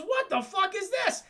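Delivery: high, startled mock outrage; shocked outburst. Goliath seeing Demona appear, shocked. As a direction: blurt it high in alarm.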